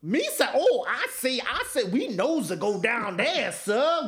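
high Jar Jar voice, sir at the end. Retold or imitated. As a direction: squeak it out like the clumsy Gungan.

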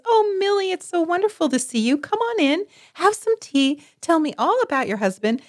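high, gushing mock-delighted hostess voice; fake sweet mother. Mother greeting the neighbour she dislikes, gushing high and fake. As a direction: coo it in a sugary two-faced welcome.

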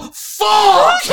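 loud, high yelp of frustration; Travis yelling. Retold or imitated. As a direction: yell it like you just got cut off. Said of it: "this is literally Travis's response".

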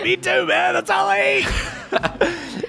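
high, slow goon laughing; Petty Jack voice. Acted.

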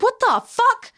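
high, shocked "What the fuck?". Retold or imitated. Reacting to Aaron taunting the ghost, shocked high outburst. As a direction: disbelief bursting out.

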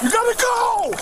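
frantic high shouting; body cam audio. Real, not acted. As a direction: yell for people to hurry out. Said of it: "Cámaras corporales de policías muestran". (gritos desesperados en cámara corporal)

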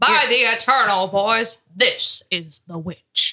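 put-on slow, drawn-out old general's pronouncement; Andrew Jackson impression. Shown as a demonstration. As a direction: slowly declare "By the eternal boys" like a general. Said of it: "say it like Andrew Jackson would have said it".

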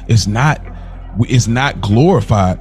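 ordinary talk, nothing marked

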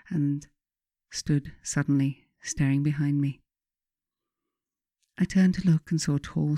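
ordinary delivery, nothing marked

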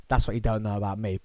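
ordinary talk

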